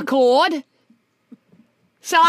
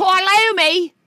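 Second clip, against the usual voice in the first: hammed-up Cockney, louder; mock Cockney exclamation; 4 semitones higher, 5 dB louder, slower than usual. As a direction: bellow Cor Lummy like a Cockney cabbie. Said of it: "your Cockney accent is perfect".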